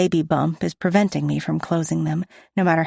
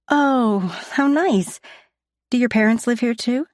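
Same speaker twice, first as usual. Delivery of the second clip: put-on higher, sing-song voice for children; talking to a child; 6 semitones higher than usual. A narrator's reading. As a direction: brightly humour the little girl. Adult speaking to children.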